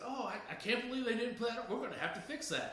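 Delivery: clumsy British caller voice, sliding back down; bad British accent. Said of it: "my British accent is really bad".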